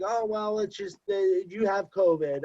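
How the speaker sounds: high, sing-song imitation of the caller; mock nurse voice.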